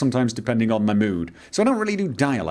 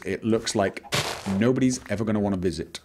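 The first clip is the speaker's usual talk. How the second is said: usual speaking voice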